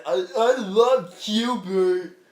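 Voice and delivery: slow, dopey mock-devoted drone; lobotomized audience member. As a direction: drag it out like a mindless fan. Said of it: "lobotomized some Girl Scouts".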